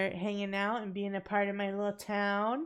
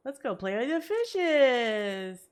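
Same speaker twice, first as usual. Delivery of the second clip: cat voice; 4 semitones higher, 7 dB louder, slower than usual. Pretend. Voicing the cat in game.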